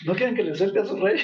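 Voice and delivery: quick, needling jab of a question; mocking, needling. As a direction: rub it in like salt in a wound. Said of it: "como poniendo el dedo en la llaga".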